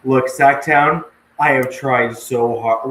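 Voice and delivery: hammed-up pleading with his co-host; mock exasperated plea. Host teasingly begs co-host about his accent, loud and hammed-up.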